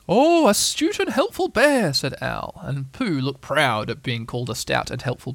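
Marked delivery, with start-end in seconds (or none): 0.1-1.9 high, slow, grand owl voice; pompous Owl. Acted. Owl flattering Pooh, high and slow. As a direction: puff up like a self-important old owl.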